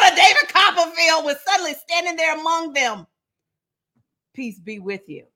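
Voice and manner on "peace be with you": much softer, low and serene; calm Jesus greeting. Acted. Jesus greeting the frightened disciples, softly and calmly. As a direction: soothe a startled room. Jesus appears among them.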